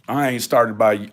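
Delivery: ordinary delivery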